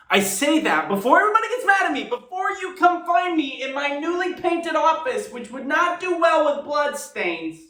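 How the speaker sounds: high, fast, pre-emptive protest; mock-defensive plea. Reactor heading off angry fans, mock-defensive. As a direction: rush it out high-pitched, playfully defensive.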